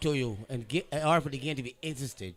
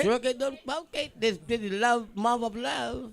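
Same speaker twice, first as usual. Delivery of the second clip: high sing-song; 10 semitones higher than usual. Retold or imitated. High sing-song repeat of saying.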